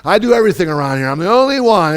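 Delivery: fast, high, loud martyr's whine; self-pity.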